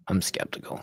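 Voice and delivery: whispered aside. Shown as a demonstration. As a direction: drop to a whisper on the repeat. Repeated, second take whispered.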